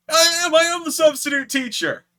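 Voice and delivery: high, loud, thrilled reply; excited. Retold or imitated. Fan thrilled his hero recognises him, high loud excited reply. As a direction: burst out with delight at being recognised.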